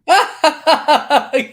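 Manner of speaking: high, loud staccato cackle; loud laughter. Real, not acted. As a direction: burst out laughing in pulses. Listener laughs at the story.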